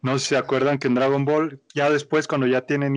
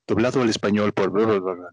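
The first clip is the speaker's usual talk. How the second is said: imitando la voz grave de un locutor de créditos; locutor de doblaje; 5 semitones lower than usual. Retold or imitated. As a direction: recita en tono bajo, como anuncio de estudio de doblaje. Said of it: "te dicen en el estudio de doblaje". (voz de locutor de doblaje en español)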